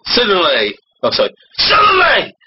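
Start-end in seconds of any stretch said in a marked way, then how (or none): 1.6-2.3 high, sudden exclamation; dramatic narration. A narrator's reading. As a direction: burst in with suspense, pitched way up. Restarts narration with mock drama.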